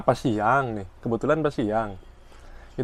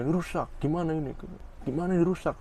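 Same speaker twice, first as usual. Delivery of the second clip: mock complaint; 5 semitones higher, 3 dB quieter, faster than usual. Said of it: "Makin marah dia".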